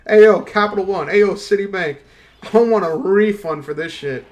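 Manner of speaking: loud, hammed-up demand for a refund; mock yelling. Imagining calling his card company, loud mock yell. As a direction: holler at the bank like you're furious.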